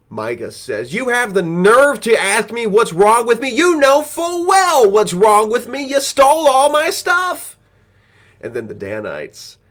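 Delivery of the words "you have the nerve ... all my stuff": much higher and louder, slowing to a furious end; outraged yell. Retold or imitated. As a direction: scream at the thieves in disbelief. Micah confronts Danites over theft.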